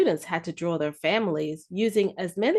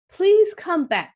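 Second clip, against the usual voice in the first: high, loud, slow plea; children calling out, begging the crayons loudly; pleading call; 8 semitones higher, 6 dB louder than usual. Acted. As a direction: beg them loudly to come back.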